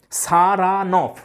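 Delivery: সারানফ slowly, as sa-ra-nof; three separate syllables. Shown as a demonstration. Teacher showing the name's syllables, সারানফ slowly split in three. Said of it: "তিনটে syllable আমরা দেখতে পাচ্ছি".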